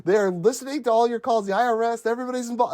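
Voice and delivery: high, insistent acted voice; urgent lawyer warning. Lawyer warning his client by payphone, acted urgency.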